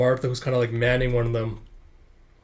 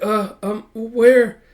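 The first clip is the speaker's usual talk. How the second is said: hesitant, high-pitched questioning; confused stammer; 9 semitones higher, 7 dB louder, much slower than usual. Acted. Confused patient facing stern woman.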